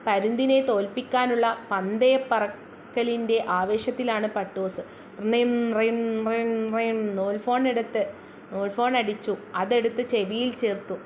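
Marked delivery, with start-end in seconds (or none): 5.2-7.2 drawn-out, trilled "rin" on one steady note; imitated phone ring. String-phone rings for the flying Pattoos, imitated ringing.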